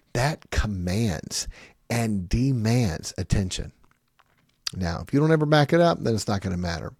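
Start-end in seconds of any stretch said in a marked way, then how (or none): 0.1-3.7 low, drawn-out deliberate emphasis; slow, commanding. Shown as a demonstration. Telling parents what commands attention, slow and commanding.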